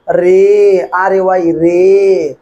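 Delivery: letters spaced far apart; teacher slowly spelling for the class. Shown as a demonstration. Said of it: "teacher spelling slowly".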